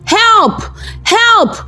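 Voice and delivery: screaming for help. Acted. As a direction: call out high for rescue. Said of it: "they started screaming".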